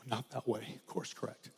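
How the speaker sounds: near-whispered gentle correction; soft whisper. Retold or imitated. The Spirit gently telling the listener, whispered. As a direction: breathe it softly like the Spirit. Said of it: "is telling you".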